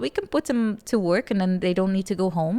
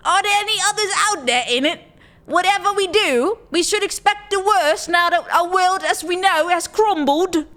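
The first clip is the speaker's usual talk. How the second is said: hammed-up British accent, higher and louder; fake British accent; 10 semitones higher, 5 dB louder than usual. Streamer reading the game's story in a fake British accent. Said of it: "worst fake British accent in the world".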